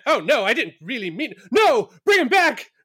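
high, slow panicked backpedal; flustered high voice. Acted. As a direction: squeak out a flustered take-back. Acted panicked take-back quote.

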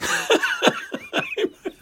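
squealing high laugh. Real, not acted.

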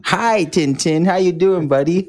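high, drawn-out friendly hello. Real, not acted. Greeting a small child.

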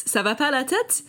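high, lilting "Ça va pas la tête?" with a rising "tête"; teasing exclamation. Shown as a demonstration. Jen showing listeners how the phrase is said, playfully incredulous. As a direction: toss it off like a playful are-you-crazy. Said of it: "you have to say it like that".

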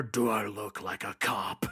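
slow, put-on vigilante voice; Batman impression. Batman answering the corrupt cop, slow menacing voice. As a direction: drag out the menacing question. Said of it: "it's when he gets the".

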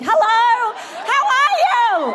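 high-pitched, drawn-out hello to a crowd; excited crowd greeting. Real, not acted. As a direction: greet the audience high and stretched out.

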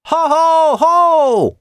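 very high, drawn-out battle whoop; long war whoop. A narrator's reading. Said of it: "kiáltott Kolnai". (elnyújtott, magas csatakiáltás)

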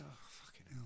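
soft under-breath swearing; muttered curse. Retold or imitated. Himself reacting, quiet muttered curse. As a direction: mutter it to yourself.

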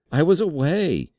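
loud, high-pitched desperate protest; panicked outburst; terrified cry. A narrator's reading. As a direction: cry it out in helpless terror. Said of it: "he cried".

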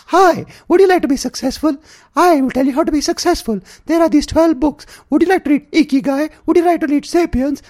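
high, loud smarmy pitchman; smug self-help ad guy. Retold or imitated. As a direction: oversell success like a guru. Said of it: "he sounds exactly like that, the guy with the mustache".

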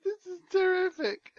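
high squeal through laughter; helpless laughter. Real, not acted. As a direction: gasp it out while laughing. Overcome with laughter.

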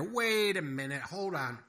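exasperated little mouse; flustered mouse. A narrator's reading. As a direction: stop everything, mouse in a tizzy. Henry the mouse's lines.

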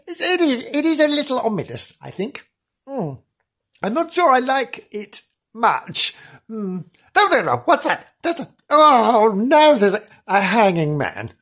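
a hint of a nervous ghost-hunter; spooky roving reporter. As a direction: tiptoe through the haunted theatre, half spooked.

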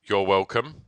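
loud mock-presidential reply; Donald Trump. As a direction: answer in character, badly impersonated. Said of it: "I'm Donald Trump, right?".

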